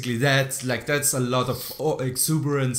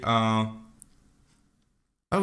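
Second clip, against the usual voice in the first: ordinary talk, nothing marked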